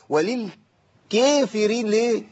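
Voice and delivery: وللكافرين with the kaf and fa thinned far too much; overdone thin kaf. Shown as a demonstration. Teacher mocking the overdone thinning in وللكافرين. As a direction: overthin the kaf in وللكافرين, strained and slow. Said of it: "ليه تعمل في نفسك كده؟".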